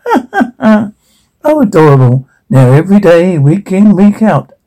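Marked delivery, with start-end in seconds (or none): 0.1-0.9 put-on girlish ha-ha laugh; acted laugh. Narrator voices the girls' response, acted laughing. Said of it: "Girls laugh".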